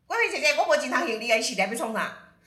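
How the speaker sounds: loud, high, fast yell through the door; shouting from inside. Retold or imitated. Client yells at Guifei through the door, loud and high. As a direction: call out from inside, fending her off. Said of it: "他在里面就喊".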